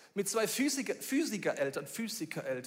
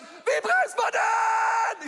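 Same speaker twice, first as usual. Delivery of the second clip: high, slow, loud panicked yell; sledding out of control, shouting for help; panicked scream; 9 dB louder, much slower than usual. Retold or imitated. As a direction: scream it down the slope, not knowing how to brake. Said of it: "Und sie schreit".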